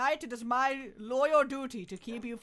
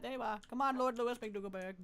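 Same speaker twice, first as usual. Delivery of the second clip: normal talking voice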